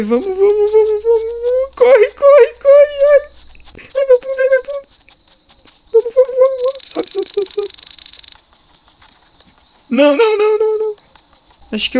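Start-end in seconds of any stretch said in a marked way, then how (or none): 0.0-2.9 panicking gamer. Real, not acted. Running from monster, shrill yells. 3.1-4.9 high pained whimper; panicking gamer. Real, not acted. Hurt while fleeing. 5.9-11.0 high frantic pleading; panicking gamer. Real, not acted. Still fleeing, high frantic.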